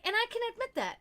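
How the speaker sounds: high-pitched mock confession; mocking admission. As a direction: imitate someone grandly owning up. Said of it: "he's mocking me".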